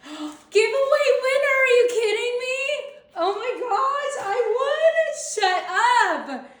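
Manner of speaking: loud, high, thrilled shrieking; screaming with real joy; shrieking jackpot winner. Real, not acted. Learns she won giveaway.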